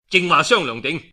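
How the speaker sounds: ordinary delivery, nothing marked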